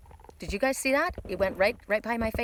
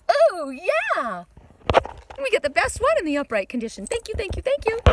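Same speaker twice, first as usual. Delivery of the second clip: high, loud joyful thanks; excited delight; 11 semitones higher, 6 dB louder than usual. Real, not acted. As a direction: burst out in real delight. Delighted at the drawn card.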